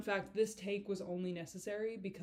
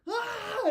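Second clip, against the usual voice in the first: sudden high sharp yelp, retold; mock scream; 9 dB louder than usual. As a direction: imitate a friend screaming in your face. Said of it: "start screaming in my face".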